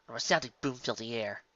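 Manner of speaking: ordinary delivery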